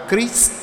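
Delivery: "Christ" said the French way with an ee vowel; drawn-out French "Christ", ee in the middle; French pronunciation. Shown as a demonstration. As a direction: say "Christ" as French, rhyming with "east". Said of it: "Tiếng Pháp chữ Kitô đọc là".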